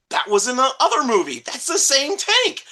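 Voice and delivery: as the wife, suddenly connecting the dots; high, loud retold discovery; wife's excited realisation.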